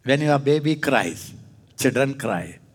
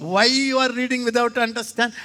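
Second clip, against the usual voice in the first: mock questioner; 8 semitones higher than usual. Voices a questioner.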